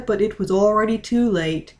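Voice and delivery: plain reading voice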